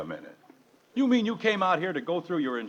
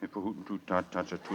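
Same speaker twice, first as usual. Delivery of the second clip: quiet, low laughing babble; chuckling nonsense; 7 semitones lower, 7 dB quieter than usual. Acted. Father answers Mister Gribble's threat with nonsense, softly laughing. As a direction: mumble the rhyme through a chuckle.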